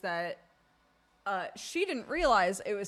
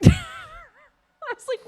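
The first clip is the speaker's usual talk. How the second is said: bursting out laughing; high wheezing laugh; 10 dB louder, much slower than usual. Real, not acted. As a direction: laugh through the words. Burst of laughter mid-story.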